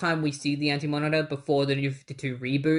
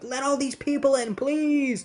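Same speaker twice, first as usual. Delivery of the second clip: higher, urgent begging; desperate plea; 9 semitones higher than usual. Retold or imitated. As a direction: beg them to open up. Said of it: "Green Arrow is just like".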